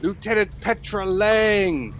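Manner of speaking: high theatrical voice, slowing to a flourish; grandiose hero. Acted. Hero spots the lieutenant he seeks, grandiose. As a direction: stretch out the discovery like a showman.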